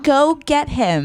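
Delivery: high, slow mock Poison Ivy; Poison Ivy command. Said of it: "so she'd be like".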